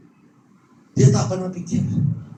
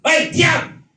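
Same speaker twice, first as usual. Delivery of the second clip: high, forceful shouted command; sharp, commanding yell; stern rebuking shout; 10 semitones higher, 3 dB louder than usual. Acted. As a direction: shout it to silence a demon. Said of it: "Yesus membentak".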